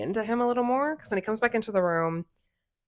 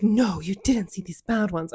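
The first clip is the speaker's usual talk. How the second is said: mock bad acting; 2 semitones higher, 3 dB louder than usual. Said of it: "badly acting towards him".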